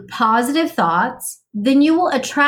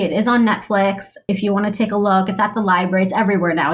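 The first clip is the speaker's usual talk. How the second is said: usual speaking voice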